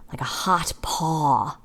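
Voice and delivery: breathy hush on the image; hushed narration of the hot sun; whispered. A narrator's reading. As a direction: whisper it, slow. Unvoiced narration.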